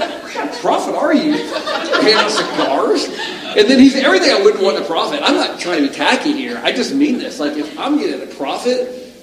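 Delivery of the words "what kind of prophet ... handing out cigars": higher, laughing mock outrage, drawn out at the end; laughing disbelief. A preacher mocking the odd prophet, laughing incredulously. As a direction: scoff at a cigar-giving prophet. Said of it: "I'm like".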